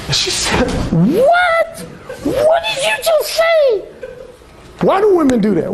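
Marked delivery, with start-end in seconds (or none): 0.9-3.8 startled laughing "what"; imitating her outraged disbelief; shocked woman.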